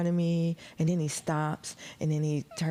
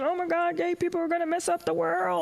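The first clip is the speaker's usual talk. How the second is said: mock outrage; 10 semitones higher than usual. Mocking straight people's complaints.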